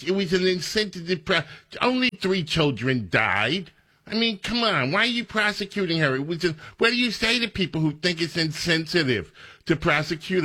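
high, incredulous mock question; sarcastic high-pitched send-up of the reporter. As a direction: parrot the question with scornful disbelief. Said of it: "mocking the reporter's question".

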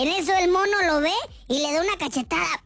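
ordinary talk

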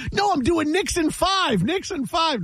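high-pitched mock yell; insistent high chant without full volume; retold yelling. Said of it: "you're just yelling at him".

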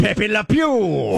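slow, high mock-French croon; hammed-up French accent. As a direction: stretch it out like a cartoon Frenchman. Likening the Frenchman to cartoon skunk.

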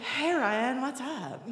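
softly tossed off, dropping away to very quiet; playing super cool. Girl answering her crush's phone call, playing super cool. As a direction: act casual for your crush. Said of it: "So I was super cool".

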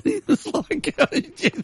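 high laughing retelling. Real, not acted. Laughing as he tells how the gardener panicked. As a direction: giggles through the quote.

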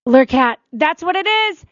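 thrilled thanks to the listener who knew; excited discovery. Real, not acted. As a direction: cry out with joy at the answer. Someone finally names the restaurant.

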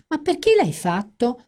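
high, plaintive whine; whiny complaint. A narrator's reading. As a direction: complain like a let-down friend. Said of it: "si lamentò Fran".

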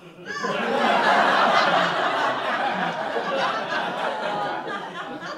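sharp delighted gasp, up high; excited gasp. Retold or imitated. Spotting the sandwich guy, an excited gasp. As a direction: gasp with sudden glee.

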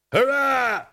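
high, loud cheer; crew's yell. A narrator's reading. Bored crew cheering at news of flotsam, high yelled hurrah. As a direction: yell it like a whole pirate crew. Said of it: "yelled the crew".

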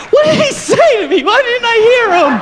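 very high-pitched, hammed-up cry of confusion; baffled victim's wail. Beaten man wondering what was said, high mock wail.

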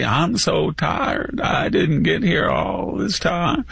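mock put-on accent, high and drawn out; slow, high-pitched parody of a pandering accent; Hillary Clinton. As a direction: stretch out a hammed-up fake accent, pitched high. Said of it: "or it's Hillary".

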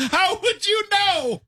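very high, drawn-out exclamation; incredulous outcry. Acted. Co-host challenging her claim about cats, high incredulous cry. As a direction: squeal the question out slowly. Shouted challenge amid teasing.

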